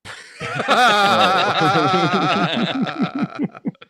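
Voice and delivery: loud, high, long laugh; real helpless laughing fit; big burst of laughter. Real, not acted. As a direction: crack up hard at a joke. Bursts out at the sex joke.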